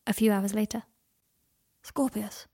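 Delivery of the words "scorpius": weak whispered name. A narrator's reading. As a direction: breathe the name, barely voiced. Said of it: "he said".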